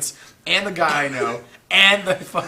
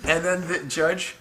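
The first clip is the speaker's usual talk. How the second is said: usual speaking voice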